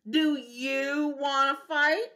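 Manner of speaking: slow, loud taunting challenge; slow bossy bug voice; grouchy challenge. Acted. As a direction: drag out the dare. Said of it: "grouchy challenge".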